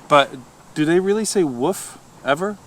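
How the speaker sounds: normal talking voice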